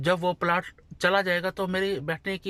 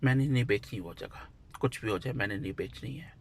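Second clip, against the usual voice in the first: softer, lower, gentle firm refusal; very lovingly; 5 semitones lower, 7 dB quieter than usual. Retold or imitated. Telling the relative who offered money, gently refusing. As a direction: lower and softer, kindly turning the buyer down. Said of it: "मैंने बड़े प्यार से बोला".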